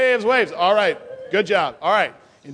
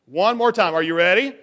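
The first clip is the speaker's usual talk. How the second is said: ordinary talk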